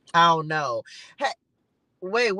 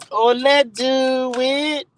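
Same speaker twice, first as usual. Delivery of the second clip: drawn-out hype; 7 dB louder, much slower than usual. Real, not acted. Hyping herself up on live.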